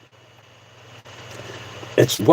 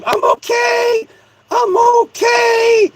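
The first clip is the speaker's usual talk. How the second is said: puppet voice; slower than usual. Pretend. Puppet Mister Obama says he is fine after the scare, squeaky high voice. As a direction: reassure in a tiny squeaky puppet voice.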